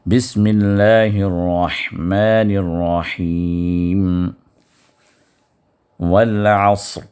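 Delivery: drawn-out, measured tajweed chant; slow Quran recitation. Shown as a demonstration. Teacher reciting Surah Al-Asr for students, slow chant. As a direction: recite each word slowly and melodically.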